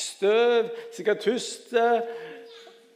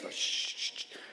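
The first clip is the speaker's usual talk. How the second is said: soft, whispered repeated shush; acted shushing; 11 dB quieter, slower than usual. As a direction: hush the noisy one at the back. Acts disciples hushing the caller.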